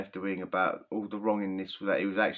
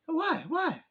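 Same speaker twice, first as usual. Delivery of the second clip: innocent why. Retold or imitated. Inmate protesting innocently.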